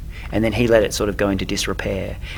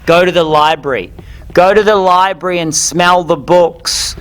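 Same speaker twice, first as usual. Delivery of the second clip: building emphatic advice; 6 semitones higher, 5 dB louder than usual. Real, not acted. Building advice, louder each time.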